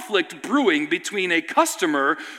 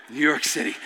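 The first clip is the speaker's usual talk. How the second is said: low, soft, dry punchline; understated weary aside; deadpan refrain; 7 semitones lower, 5 dB quieter than usual. Retold or imitated. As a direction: drop it low, resigned to the chaos. Dry refrain after van disaster.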